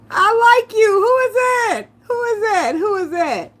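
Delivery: high, loud, excited; excited squeal. Real, not acted. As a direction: gush over the name like a fan. Excited reaction to a commenter.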